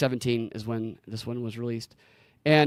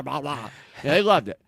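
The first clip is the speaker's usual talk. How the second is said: ordinary delivery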